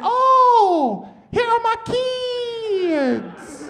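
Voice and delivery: put-on teacher finding her kids, drawn out high and slow; relieved teacher. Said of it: "she said".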